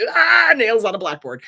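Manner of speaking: mock-pained loud complaint; pained groan. Real, not acted. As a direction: wince out loud at a grating voice. Reacting to narrator.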